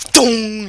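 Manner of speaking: high, loud "dong"; imitated impact. Game master sounds Foxy hitting the bar, loud imitated clang. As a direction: boom out a ringing dong.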